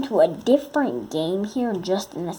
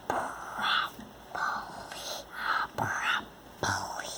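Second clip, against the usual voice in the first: slow whispered broccoli; 9 dB quieter, slower than usual. Pretend. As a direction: breathe the words out slowly. Whispered nonsense.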